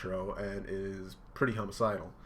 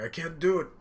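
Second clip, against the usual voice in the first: high, anguished protest of a character; acted crying; 8 semitones higher, 4 dB louder than usual. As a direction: plead helplessly, as if in tears. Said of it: "Ichiro is crying".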